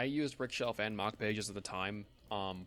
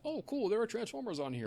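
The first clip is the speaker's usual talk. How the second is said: much higher, eager, imitating his younger self; excited discovery; 12 semitones higher than usual. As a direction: relive finding something great. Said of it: "and I'm like".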